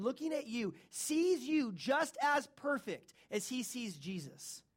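high, loud, ringing sermon build; preaching at full force. Acted. Preacher exhorting congregation about justification, rising to full force. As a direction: lift the room toward the altar call.

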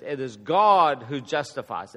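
God punched loud in mid-sentence; "God" swelling well above the words around it; stress on God. Shown as a demonstration. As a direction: lean hard on the word God. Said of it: "And the emphasis is on".

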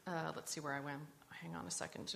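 ordinary delivery

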